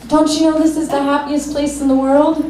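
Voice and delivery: trolley loudspeaker guy. Retold or imitated. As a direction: call out like a park guide on a loudspeaker. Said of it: "the loudspeaker saying".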